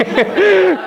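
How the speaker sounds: very high burst of laughter; high amused laugh. Real, not acted. Laughing about the brother's words.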